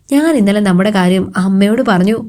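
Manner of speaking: ordinary delivery, nothing marked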